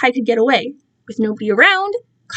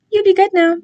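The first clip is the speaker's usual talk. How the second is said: condescending captor; 8 semitones higher, 3 dB louder than usual. Acted. Captor parting words.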